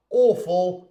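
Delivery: British AWFUL, rounded "aw" at the start; British accent. Shown as a demonstration. Teacher repeating the British version, British accent. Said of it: "in British English".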